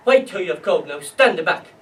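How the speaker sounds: forceful, acted command; barked order. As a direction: shout the order down at them. Officers repeat the shouted order.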